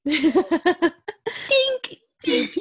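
high, giggly glass clink sound; very excited tink. Acted. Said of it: "That's a very excited tink".

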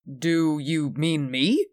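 slow, puzzled question; mildly taken aback, drawing the question out; surprised. A narrator's reading. Peterson questioning French at the door, mildly surprised.

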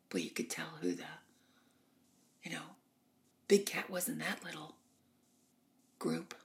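normal talking voice

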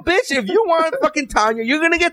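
put-on high, brash hustler pitching a girl; pushy pimp. Pimp pushing Tanya on the president, high and brash.

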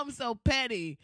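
normal talking voice

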